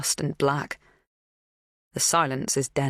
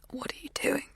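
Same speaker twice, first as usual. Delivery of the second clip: breathless, frightened whisper; small scared whisper; 11 semitones higher, 9 dB quieter than usual. A narrator's reading. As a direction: barely get the words out. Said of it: "My voice is too small".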